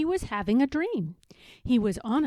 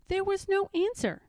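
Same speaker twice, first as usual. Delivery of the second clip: ordinary delivery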